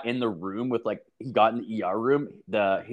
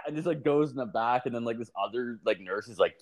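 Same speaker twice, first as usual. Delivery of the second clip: usual speaking voice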